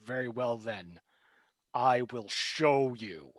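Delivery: soft, slow ominous threat; quiet menace. Acted. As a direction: promise it quietly and darkly. GM voices the villain figure.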